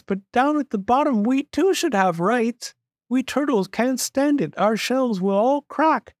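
very high, pained pleading voice; small, high, suffering underling; groaning little turtle. A narrator's reading. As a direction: plead from the bottom of a heavy stack. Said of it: "groaned Mac".

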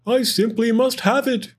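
a squirrel pining for his prize; wistful cry. A narrator's reading. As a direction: yearn for the pine cone. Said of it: "he wistfully cried".

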